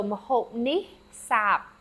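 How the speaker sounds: Mahop nih sap said slowly, word by word; careful classroom Khmer sentence; Khmer phrase. Shown as a demonstration.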